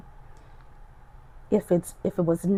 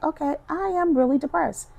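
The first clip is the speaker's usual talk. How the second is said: sudden realization; 9 semitones higher than usual. Retold or imitated. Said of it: "I'm like".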